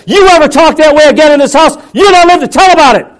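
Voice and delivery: screaming threat at full force; enraged parent screaming at a child; furious yell. Retold or imitated. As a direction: yell it high and hard with every ounce of strength. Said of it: "I yelled at him".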